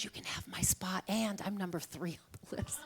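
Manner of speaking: unvoiced laughing whisper; whispered offer. Friend at the table offering her spot, in a laughing whisper. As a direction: whisper it with a giggle. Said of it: "she goes".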